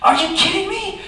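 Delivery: high-pitched indignant disbelief; mad. Retold or imitated. Comic reliving anger at being tricked, mad disbelief. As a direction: squeal it in comic outrage. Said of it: "I was just mad".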